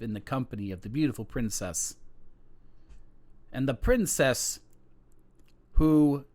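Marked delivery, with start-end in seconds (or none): none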